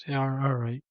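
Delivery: sighing, deflated yeah; resigned sigh. Retold or imitated. Answering God's rebuke, sighing and giving in. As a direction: let the air out, resigned agreement. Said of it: "I was like".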